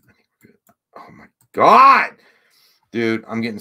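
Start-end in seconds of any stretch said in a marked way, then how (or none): 0.1-0.6 breathy whispered relief; hushed whisper to himself. Real, not acted. 1.0-2.1 high, loud, stretched out cry; drawn-out frustrated yell; exasperated shout. Real, not acted. Exploding at broken mouse.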